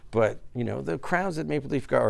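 ordinary talk

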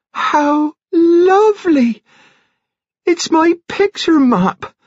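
very high, drawn-out rapture; soaring, overjoyed exclaiming; ecstatic delight. A narrator's reading. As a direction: squeal with joy over a treasured gift. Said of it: "she cried in an ecstasy".

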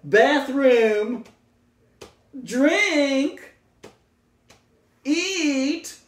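playful silly voice for button messages. Shown as a demonstration. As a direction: squeak the words out like a cartoon. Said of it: "that's my silly voice".

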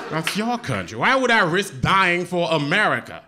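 usual speaking voice

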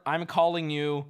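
normal talking voice

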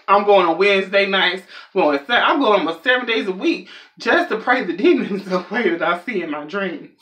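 ordinary talk, nothing marked

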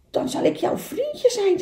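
high-pitched small animal; little beaver voice. Acted. Little beaver offering the duck friendship, high voice.

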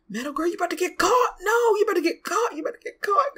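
high, rising alarmed warning; squealing playful alarm; excited warning. Real, not acted. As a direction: urgently warn her she'll be caught. Sims player warning character.

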